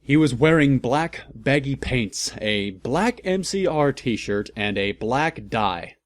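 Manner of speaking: ordinary talk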